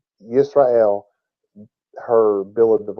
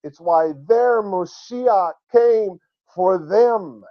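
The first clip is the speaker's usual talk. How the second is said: high, building to very slow drawn-out words; emphatic preaching; 7 semitones higher, slower than usual. Real, not acted. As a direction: proclaim it, stretching "their God reigns". Climax of the sermon's point.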